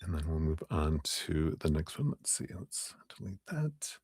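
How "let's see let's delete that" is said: whispered aside. Real, not acted. Muttered aside while editing.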